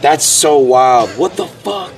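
normal talking voice

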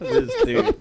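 laughing through the words; cracking up mid-word. Real, not acted. Laughing at friend's prank.

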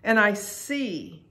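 "see" drawn out slowly; stress on see. Real, not acted. Preacher describing spiritual sight, slow emphasis on "see". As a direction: stretch the word "see" and let it hang. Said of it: "an emphasis on the word see".

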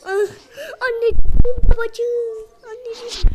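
over-the-top pretend lament; mock wail. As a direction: wail it like a dramatic loss. Wails after dying in game.

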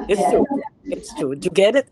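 ordinary delivery

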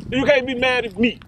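ordinary talk

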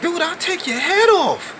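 high, mocking cocky threat. Retelling his threat to the shorter trainer, high and mocking. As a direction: squeak out a cocky boast. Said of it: "I'm like".

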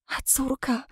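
breathless whisper; old mother whispers about her granddaughter. A narrator's reading. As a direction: hush it out in a frightened whisper. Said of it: "wyszeptała staruszka".

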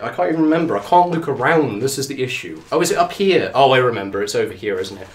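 ordinary talk, nothing marked

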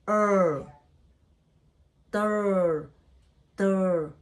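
long held "Er", then "Ter" with drawn-out vowel; sounding out TER. Shown as a demonstration. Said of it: "U, R have a sound".

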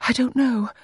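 high, uncertain repetition; high, voiced repeat. A narrator's reading. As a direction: say it aloud, pitched high. Repeats it after the near-whisper.

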